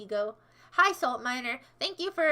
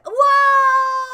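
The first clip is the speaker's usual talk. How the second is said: sudden high loud whoa; startled shout; 11 semitones higher, 14 dB louder than usual. Real, not acted. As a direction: yelp in real surprise. Startled by viewer.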